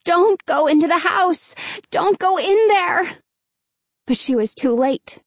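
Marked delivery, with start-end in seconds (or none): 0.0-3.2 high, urgent pleading. Acted. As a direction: beg them not to go in. Pleading with boys not to enter.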